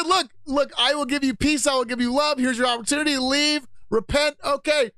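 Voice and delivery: lightly hammed-up pastor; high pastor voice. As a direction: plead like the preacher in the story. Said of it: "He said".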